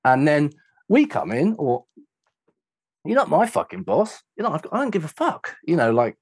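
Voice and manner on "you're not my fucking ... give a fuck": high-pitched, indignant comeback; defiant retort. Retold or imitated. Visiting band answers the venue bully, indignant defiance. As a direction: toss it back with raised-pitch defiance.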